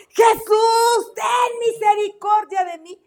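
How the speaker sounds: loud, high cry for mercy; full-voiced, drawn-out plea; strong desperate shout. Shown as a demonstration. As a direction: shout so Jesus hears you passing. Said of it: "pero fuerte".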